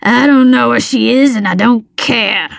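surly villain voice; mildly snarled retort. A narrator's reading. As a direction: snarl it with open indifference. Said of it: "he snarled".

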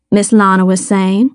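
ordinary delivery, nothing marked

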